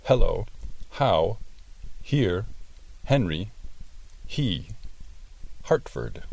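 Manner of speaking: "Hello", "Henry", "Hartford" each opened with a small puff of air; light breathy H. Shown as a demonstration. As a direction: open mouth and throat, a little gasp on each H. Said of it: "Dices la H con boca y garganta abierta".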